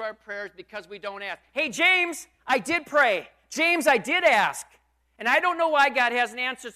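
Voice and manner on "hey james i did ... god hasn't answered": loud, higher, pleading objection; mock protest. Pastor talking back to James in mock protest, loud and high. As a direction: argue back at the apostle. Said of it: "pastor protests to James".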